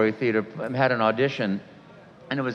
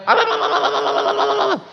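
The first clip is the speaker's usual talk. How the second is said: high, loud, laughing panicked babble; 6 dB louder than usual. Retold or imitated. Nervous student babbling before his first monologue, high and loud.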